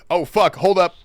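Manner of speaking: loud startled outburst; surprised. Real, not acted. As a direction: blurt it out suddenly. Sudden streamer outburst.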